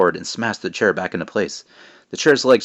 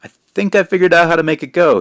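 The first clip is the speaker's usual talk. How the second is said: high, strained effortful voice; grunting with effort; 9 semitones higher, 7 dB louder than usual. Acted. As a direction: talk while wrestling the broom. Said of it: "grunting as he held".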